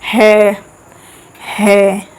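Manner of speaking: "है" sounded alone from the throat; glottal "ह". Shown as a demonstration. Teacher says try saying it, glottal demo of "है". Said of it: "स्वर यंत्र है।".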